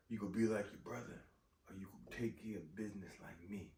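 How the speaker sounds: quiet sinister villain whisper; low tempting Killmonger. Retold or imitated. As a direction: hush it like a voice in her head. Killmonger quoted tempting her.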